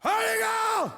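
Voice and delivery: high calling-out cry to the men; calling the crowd. Real, not acted. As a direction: crowd cue for the guys. Cueing the men to shout.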